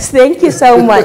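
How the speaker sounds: amused, laughing sign-off; laughing thanks. Real, not acted. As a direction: chuckle as you wrap up the interview. Host chuckles while closing interview.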